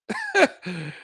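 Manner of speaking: a sudden disbelieving laugh, pitched high; high burst of laughter. Real, not acted. A web developer laughing at the prank page that replaced the client's site. Reading the ball-sack site's headline.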